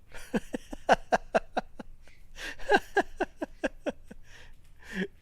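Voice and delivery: high, airy fit of laughter; long amused laugh. Real, not acted. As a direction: laugh on and on, mostly breath. Amused reaction to live chat.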